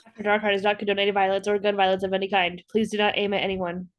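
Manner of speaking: quick, low, monotone legal disclaimer. Pretend. Co-host adding a gun-violence disclaimer to a fake ad, rapid monotone. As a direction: rattle it off like small print.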